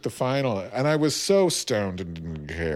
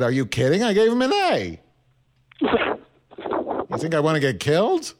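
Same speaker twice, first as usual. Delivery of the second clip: incredulous New York teacher, pitched up and louder; New York accent; 6 semitones higher, 3 dB louder than usual. Retold or imitated. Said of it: "say stuff with this accent".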